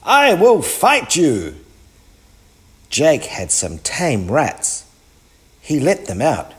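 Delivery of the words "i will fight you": high, loud threat; storybook giant crying out for a fight; giant's cry. A narrator's reading. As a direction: throw down the challenge, voice raised. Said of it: "cried the giant".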